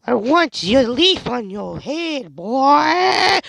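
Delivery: very high, slow, whiny cartoon voice; high-pitched wail of a silly character; squeaky character voice. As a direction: pitch way up and drag it like a whining kid. Voices a game character Andy.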